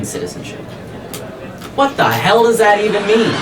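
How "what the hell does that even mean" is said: higher and louder, laughing in disbelief; exasperated disbelief. Real, not acted. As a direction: blurt it out, incredulous. Exasperated outburst at order title.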